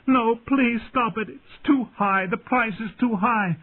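high, desperate, tearful begging; weeping plea. A narrator's reading. Dany begging over the terrible price, weeping. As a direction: beg for it to stop, voice pitched high. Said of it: "she wept".

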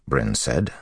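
ordinary delivery, nothing marked

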